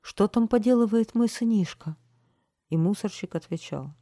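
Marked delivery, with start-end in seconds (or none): 0.0-2.0 higher, louder worried question; frightened asking. A narrator's reading. Parent asking after a little son, mildly frightened. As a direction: ask with a touch of alarm. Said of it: "И многие с испугом спрашивали".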